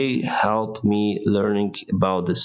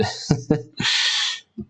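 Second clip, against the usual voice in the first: laugh; 6 semitones higher than usual. Real, not acted. Laughs after answering.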